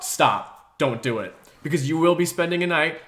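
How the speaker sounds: usual speaking voice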